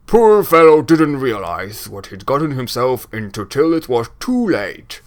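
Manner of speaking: big blustery storyteller with loud openings; booming voice. Acted. Braskin tells who bought his box, booming. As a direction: boom out the tale with relish. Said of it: "doing the booming voice".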